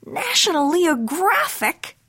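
slow, high-pitched mama cat musing. A narrator's reading. Mama reading her boy's magazine, musing. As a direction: draw it out high, pondering the find. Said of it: "mused Mama".

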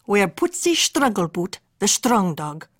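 usual speaking voice

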